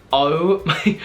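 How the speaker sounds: retold flustered gasp of delight, breaking into a laugh; freaked out. Fan hears star praise her smile, freaked out. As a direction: react overwhelmed to a compliment. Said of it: "I freaked out when she said that".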